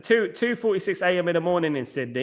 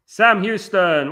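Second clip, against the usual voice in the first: drawn-out greeting; 6 semitones higher, 7 dB louder, much slower than usual. Real, not acted. Streamer greeting a chat viewer, slow and loud. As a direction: stretch the name out slow and loud.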